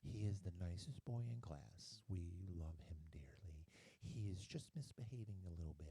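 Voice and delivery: soft, hushed fake sweetness; sweet-talking nun. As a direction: coo it like a doting teacher. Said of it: "the conversation always went".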